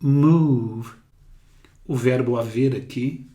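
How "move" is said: "Move" with a long drawn u. Shown as a demonstration. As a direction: stretch the vowel in "Move". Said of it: "Aqui é longo".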